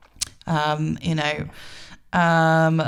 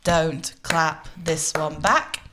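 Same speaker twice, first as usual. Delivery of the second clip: "Don't clap this one back" slow and evenly beaten out; rhythmic demo; much slower than usual. Shown as a demonstration. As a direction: say each word of "Don't clap this one back" as a separate beat. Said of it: "introduce the rhythm for".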